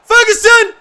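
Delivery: loud, high yell; real excitement; excited shout. Real, not acted. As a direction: scream at the chance. Shout at a chance.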